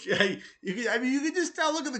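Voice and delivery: high, loud laughing words; laughing hard through the words. Real, not acted. Vlogger laughing at a photo of McGregor, loud and high.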